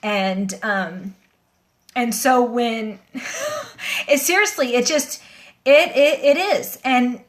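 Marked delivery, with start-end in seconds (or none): 3.0-4.0 quick soft laugh jumping much higher; nervous high laugh. Real, not acted. Explaining to viewers why it felt sacred, soft high giggle. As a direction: let a nervous giggle slip out.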